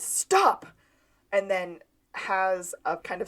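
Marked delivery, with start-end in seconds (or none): 0.0-0.7 high, loud mock scream; retold yell, pitched way up; imitated scream. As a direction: cry out suddenly, acting it. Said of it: "Sean just kind of screams".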